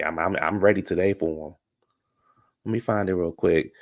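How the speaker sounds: ordinary talk, nothing marked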